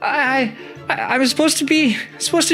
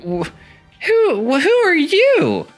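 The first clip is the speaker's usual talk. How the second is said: much higher, stammering and slow; frightened; slower than usual. Acted. Tisha seeing metal teeth, high frightened stammer. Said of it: "She's like".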